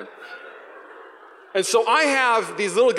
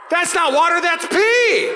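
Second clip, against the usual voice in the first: high, loud shocked shout; Susie shouting; 12 semitones higher, 6 dB louder, slower than usual. Retold or imitated. Susie realising she was hit with pee, high shout. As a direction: yell it out in disgust. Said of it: "she's like".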